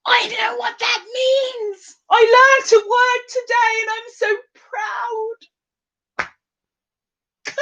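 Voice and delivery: hammed-up high proud announcement; proud mock squeal. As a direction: proclaim it in a silly high, sing-out voice.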